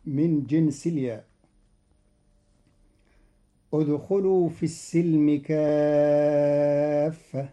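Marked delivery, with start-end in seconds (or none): 3.7-7.5 slow Quranic chanting of ادخلوا في السلم كافة; recitation. Shown as a demonstration. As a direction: intone the verse slowly as in tarteel. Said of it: "قرأ قالون كلمة السلم".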